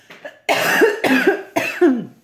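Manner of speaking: loud rasping cough fit; choking cough. Real, not acted. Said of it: "Pardon, je m'étouffe".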